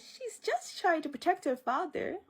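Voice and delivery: high-pitched imitation of an older lady; older ancestor lady. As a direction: plead her case in a put-on lady voice. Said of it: "And the older ancestor lady".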